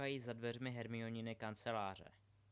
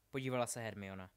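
ordinary delivery

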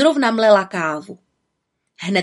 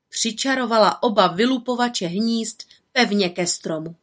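ordinary delivery, nothing marked

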